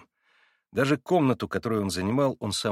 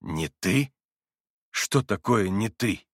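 stunned; slower than usual. A narrator's reading. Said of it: "остолбенел Иван".

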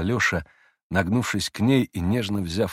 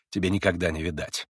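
plain reading voice

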